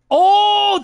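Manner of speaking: a high, loud burst of sudden approval; light up at chat's suggestion; delighted surprise. Real, not acted. Streamer hearing a chat idea, delighted surprise.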